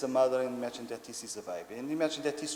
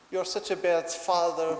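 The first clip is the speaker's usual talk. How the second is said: loud, raised mock child reproach; upset little boy; 5 semitones higher, 7 dB louder than usual. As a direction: scold daddy like a cross toddler. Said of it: "when he's upset with me".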